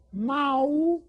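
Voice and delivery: high drawn meow; high cat meow. Shown as a demonstration. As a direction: mew like a kitten. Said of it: "Can everybody say that".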